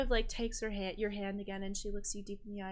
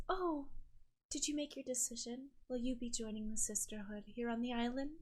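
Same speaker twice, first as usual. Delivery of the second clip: softer, lilting island sister, sweetly inquiring; 3 semitones higher, 6 dB quieter than usual. Acted. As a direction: gently invite the sorceress into the Sisterhood.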